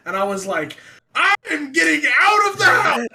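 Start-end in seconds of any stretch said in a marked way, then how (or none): 1.1-3.1 high, loud hammed-up yell; comic full-voice exclamation, pitched way up; panicked shout. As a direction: re-enact a frantic announcement of leaving. Retold panicked reply to ghost.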